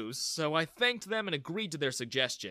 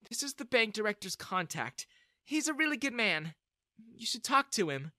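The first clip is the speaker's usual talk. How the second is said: lightly voiced mother; 8 semitones higher than usual. A narrator's reading. Mother gives card kindly.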